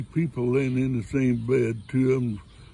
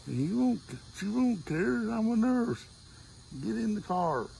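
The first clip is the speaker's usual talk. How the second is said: much higher, coaxing nurse voice; eager nurse; 9 semitones higher than usual. Retold or imitated. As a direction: sweet-talk him into the car.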